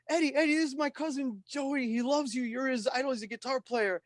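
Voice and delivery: high, eager mock-retold pitch to a star; excited introduction. Cousin introducing [name] to [name], eager and high. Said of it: "he's like".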